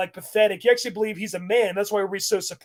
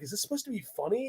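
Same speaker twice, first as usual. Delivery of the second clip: hushed aside; 10 dB quieter, faster than usual. Retold or imitated. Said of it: "He's like".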